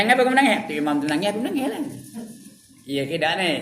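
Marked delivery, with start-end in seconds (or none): 0.1-0.6 much higher, louder quizzing voice; mock questioner. 0.7-1.8 mock reply.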